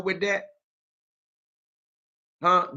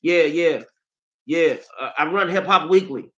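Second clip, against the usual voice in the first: mocking [name]; 3 semitones higher than usual. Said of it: "talking about".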